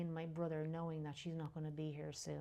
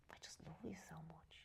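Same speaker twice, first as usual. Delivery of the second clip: whisper; 15 dB quieter than usual. Retold or imitated. Repeat whispered to dying mother.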